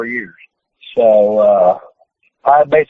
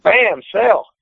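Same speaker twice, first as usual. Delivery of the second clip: high, punchy re-enactment; retold impact; 8 semitones higher than usual. Wrestler re-enacting a hard hit, excited imitation. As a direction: act out the blow and order.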